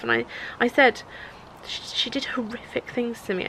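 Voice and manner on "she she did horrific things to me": unvoiced whisper of a painful truth; hushed confession. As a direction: breathe it out, barely voiced. Retelling the abuse.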